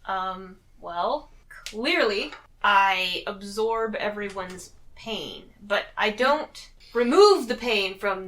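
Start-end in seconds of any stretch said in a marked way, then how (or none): none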